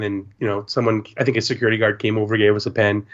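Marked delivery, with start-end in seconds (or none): none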